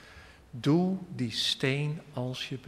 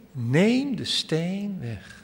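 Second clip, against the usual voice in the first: slow insistent command; 5 semitones higher, 3 dB louder, much slower than usual. Acted. Preacher repeats the command.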